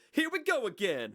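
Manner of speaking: way up high, unshifted; high own voice. Shown as a demonstration. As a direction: say it high in your natural range. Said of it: "I'm, like, way up here".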